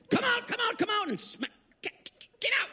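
very high, frantic mock screaming; screaming exorcist imitation. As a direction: screech it like an over-zealous deliverance team. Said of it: "start screaming at you".

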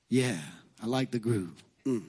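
low, laughing music lover; grooving listener voice. Pretend. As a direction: chuckle and savor the groove. Voicing a listener who enjoys music.

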